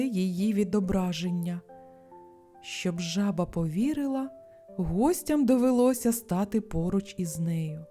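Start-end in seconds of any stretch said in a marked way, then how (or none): none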